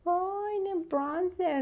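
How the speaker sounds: high-pitched mimicry of a nitpicker correcting BRONZER (برانزر); mock pronunciation correction. Viewers nitpicking her English pronunciation, mock correction.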